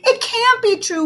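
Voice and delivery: high, loud wail of disbelief. A narrator's reading. A child wailing that her teacher is leaving. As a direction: wail it out in dismay. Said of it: "wailed Emberly".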